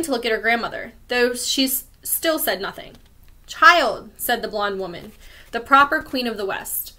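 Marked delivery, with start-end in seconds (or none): 3.5-4.1 sharp, loud single word, falling; a stern summons; commanding call. Acted. The proper queen ordering the child away, loud.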